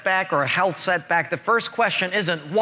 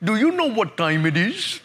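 imitated East Indian accent, rising question. Gate man questioning confused traveler, East Indian accent. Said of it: "morphed from an East Indian accent".

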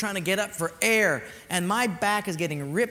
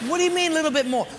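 high, rapid disbelieving question; mock incredulity; 8 semitones higher, 4 dB louder, much faster than usual. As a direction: fire back in playful alarm. Balks at going further.

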